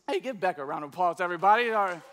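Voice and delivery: ordinary delivery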